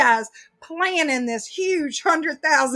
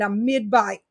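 ordinary talk

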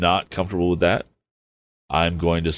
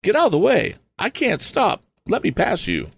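frantic cry; 14 semitones higher than usual. A narrator's reading. Tom screams at the man blocking the road, panicked shout. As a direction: yell that you can't stop. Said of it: "cried Tom".

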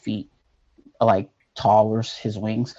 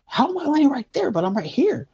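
bewildered. Retold or imitated. Confused seeing own body.

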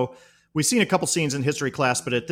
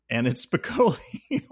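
usual speaking voice

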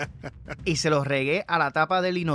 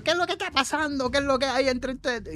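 high, scolding mother's voice, lightly voiced; a mother demanding answers from squabbling kids; mom breaking up the fight; 10 semitones higher than usual. Mom scolding the two brothers fighting over the bathroom, high and stern.

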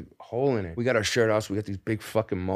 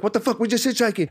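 much higher, protesting outburst; indignant shout; 11 semitones higher, 4 dB louder, faster than usual. Retold or imitated. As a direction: innocent kids caught out, protesting loudly. Confronted by bouncers.

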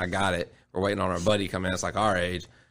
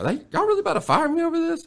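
disbelief. Retold or imitated. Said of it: "I was like".

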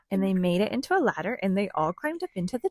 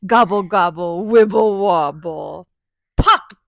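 gobbling turkey voice; 3 semitones lower, 7 dB louder than usual. Pretend. Reading turkey refrain aloud.

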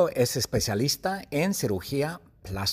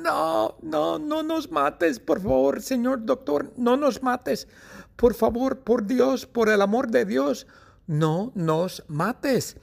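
very high-pitched frantic pleading; gritos de súplica; 13 semitones higher than usual. Acted. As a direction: beg for mercy in a high, hammed-up scream. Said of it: "y gritan, y le gritan". (súplica aguda y aterrada, exagerada)

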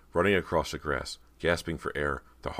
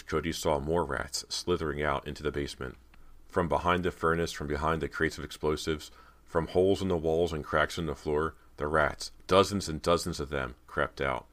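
plain reading voice

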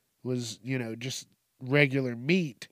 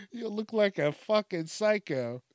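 usual speaking voice

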